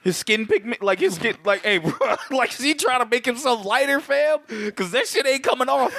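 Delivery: laughing. Real, not acted. Joking, laughing, high.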